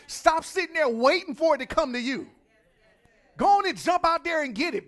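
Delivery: loud, high fiery preaching; preaching at full force. Acted. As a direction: whip up the congregation.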